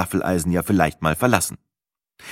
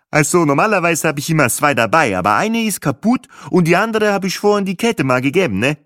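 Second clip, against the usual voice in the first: much higher, louder rambling voice; chatty group member; 10 semitones higher, 4 dB louder than usual. A narrator's reading. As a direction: babble on about the adapters. (Gruppenmitglied plappert über die Adapter, hoch und laut)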